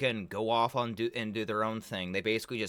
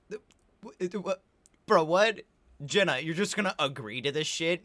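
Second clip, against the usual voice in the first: stunned disbelief; 5 semitones higher than usual. Real, not acted. Shocked reaction to Jenna.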